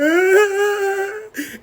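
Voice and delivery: high-pitched mock wail; imitated wailing sob. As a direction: bawl high, hammed up. Imitating girlfriend crying at the door.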